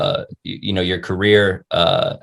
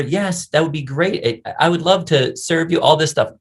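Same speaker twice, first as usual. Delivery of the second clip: eager server; 6 semitones higher than usual. Acted. Chick-fil-A worker delighted to serve a customer, eager and higher. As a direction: lightly play someone thrilled to serve.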